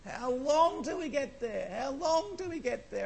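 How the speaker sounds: put-on high, slow, whining child voice; whiny kid in the car. Kids in the back seat whining to parents, high slow whine. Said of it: "like kids in the back of the car".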